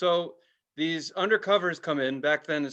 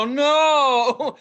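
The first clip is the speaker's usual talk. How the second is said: high, loud laughing oh no; mock dread; 9 semitones higher, 8 dB louder than usual. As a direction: whimper it in hammed-up dread. Mock dread when tripping.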